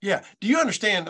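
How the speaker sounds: ordinary talk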